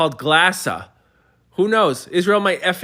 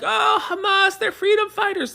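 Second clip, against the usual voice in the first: much higher mocking voice, a sarcastic caricature; high, squeaky put-on voice of a protester. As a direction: sneer it like a naive activist. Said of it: "pro-Palestine people who were like".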